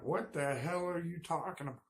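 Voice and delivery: high, incredulous outburst; indignant disbelief. Retold or imitated. Husband to wife who just called him a hillbilly, high outraged disbelief. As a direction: pitch it up, stunned and offended.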